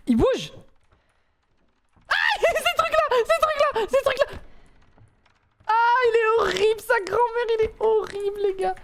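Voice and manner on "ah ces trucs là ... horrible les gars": high squealing disgust; disgusted shriek. Real, not acted. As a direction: squeal at the creepy thing. Disgusted by creepy monster.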